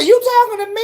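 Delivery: very high, startled "you talking to me"; shocked question. Retold or imitated. Realizing a stranger in the chat can see her, very high and startled. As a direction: squeak it out in alarm. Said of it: "I said".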